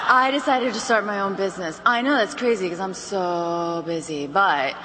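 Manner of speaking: low, listless sales pitch; tired. Shown as a demonstration. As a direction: trail off wearily, acting drained. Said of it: "you sound like this".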